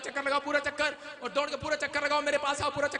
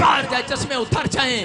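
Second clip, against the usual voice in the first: preaching at full force; 13 dB louder than usual. Acted. Healing preaching.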